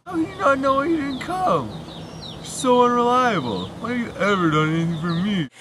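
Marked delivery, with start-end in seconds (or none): none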